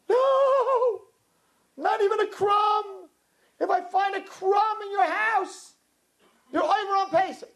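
high, slow, commanding divine decree; booming voice of God. Retold or imitated. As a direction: lay down the law as God, stern and drawn out. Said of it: "God says".

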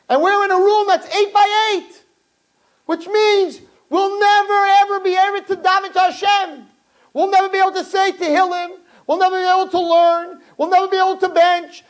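high, loud, despairing lament; anguished brother. Retold or imitated. Crying brother lamenting in the cell, high and anguished. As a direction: cry out that all is lost. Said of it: "He said".

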